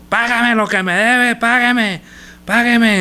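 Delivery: slow, high and insistent; demanding repayment. Retold or imitated. Servant choking his fellow servant, demanding payment slowly. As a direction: press him hard for the debt, slowly and up high. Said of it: "le ahogaba diciendo".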